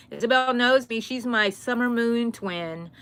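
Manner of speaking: ordinary delivery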